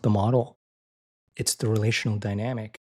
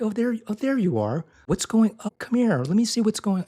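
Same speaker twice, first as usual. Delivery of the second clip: much higher, sing-song and tender; cooing parent; 10 semitones higher than usual. Retold or imitated. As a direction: coo over a hurting child.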